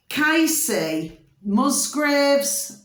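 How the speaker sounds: drawn out syllable by syllable; very slow dictation. Shown as a demonstration. Telling viewers the singer to search, very slowly. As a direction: stretch each syllable so they can type it.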